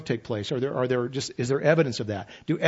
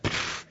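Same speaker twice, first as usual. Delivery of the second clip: unvoiced pff burst; 7 dB quieter than usual. Imploding under God's light.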